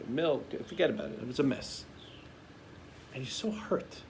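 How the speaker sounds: ordinary delivery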